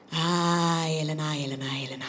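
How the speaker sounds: put-on lightly Italian-accented sighing repetition; imitating an Italian. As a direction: let the name sink with an Italian lilt. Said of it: "when an Italian says your name".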